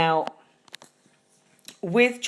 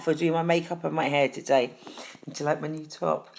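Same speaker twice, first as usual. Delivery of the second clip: ordinary talk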